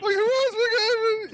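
high squealing fright with a laugh; terrified kids at a vampire movie, squealing; mock terrified squeal.

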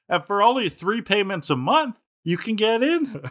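high infomercial pitch; hard sell ad. Retold or imitated. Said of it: "And he's like".

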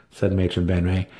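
usual speaking voice

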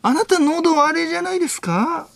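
high-pitched, mannered detective questioning; 粗品任三郎. Acted. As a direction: press the point, then stall on a long filler. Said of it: "粗品任三郎さん。". (刑事のものまねで、高い声で問い詰める)

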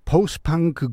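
normal talking voice

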